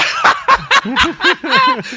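high, loud burst of laughter. Real, not acted. Pundit cracking up when a co-host teases him. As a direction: laugh out hard at being caught.

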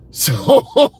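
a laugh, high and loud. Real, not acted. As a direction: laugh it off, amused. Laughs at her face.

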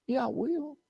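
put-on high, soft kid's retort; child talking back. Child contradicts father about Christmas, high and soft. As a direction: answer back like a cheeky child. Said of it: "I used to tell my kids".

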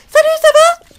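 very high, loud squeaky character voice; strained sky-high put-on voice; voix de mousse. Shown as a demonstration. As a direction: squeal a greeting in a failed falsetto. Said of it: "j'arrive même pas à faire la voix de mousse".

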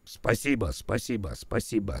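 usual speaking voice

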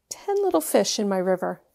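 normal talking voice

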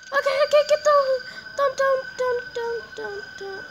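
1 panicked plea. Real, not acted. Monster approaching, panicked pleading.

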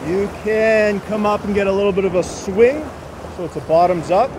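ordinary delivery

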